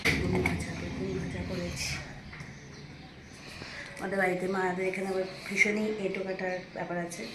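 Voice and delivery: hushed, low secretive voice; speaking softly. Real, not acted. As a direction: keep it very quiet so the parents don't hear the surprise. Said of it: "আস্তে আস্তে বলছি". (নিচু গলায় আস্তে আস্তে)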